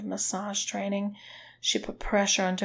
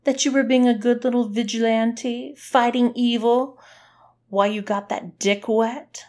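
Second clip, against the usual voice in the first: nasty taunt, pitched up and loud; 5 semitones higher, 8 dB louder than usual. A narrator's reading. Woman taunting a so-called vigilante, nasty. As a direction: throw it at them in a loud, nasty voice. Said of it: "Her voice was nasty".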